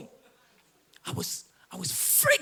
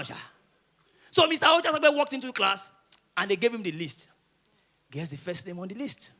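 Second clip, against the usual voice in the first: usual speaking voice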